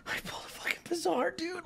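high, hushed, quick disbelief; soft, squeaky awe; excited whisper. Real, not acted. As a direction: whisper your amazing news. Disbelief at the card pulled.

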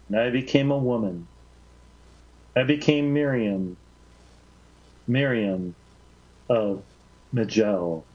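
measured, reverent reading of a poetic tale; story narration. A narrator's reading. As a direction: read it like a sacred story, unhurried. Reads Gibran story aloud.

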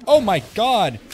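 sudden loud scared yelp; jolted by a jump scare, yelling out; startled shout. Real, not acted. Jump scare in the game makes the streamer yell, a startled loud shout.

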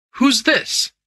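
high, urgent question; startled cry. A narrator's reading. Lord Amersteth nearly treading on Mackenzie, startled cry. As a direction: cry out with the voice jumping up. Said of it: "he cried".

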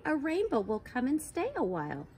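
usual speaking voice

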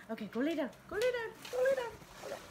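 high, fast, repeated order to the dog; telling the dog off. Real, not acted. Vlogger sending her dog back to lie down, high and quick. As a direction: shoo her to bed in a high sing-song.

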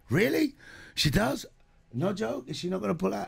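high, mock-astonished producer asking; retold surprise. Dad retelling the producers' disbelief, high and surprised.

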